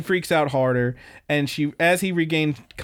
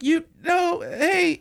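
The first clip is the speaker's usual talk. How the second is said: much higher, frantic schoolgirl cry; panicked girl; 14 semitones higher, much slower than usual. Retold or imitated. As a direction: call out after him in a high panic. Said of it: "And she's like".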